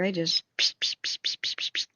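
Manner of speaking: whispered spray-can pss sounds; imitated spray hiss. Showing how the neighbor sprays spiders, whispered spray hisses.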